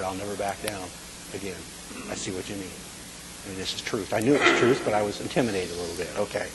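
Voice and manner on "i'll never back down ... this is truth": quiet promise. Retold or imitated. Promising God, feeling intimidated.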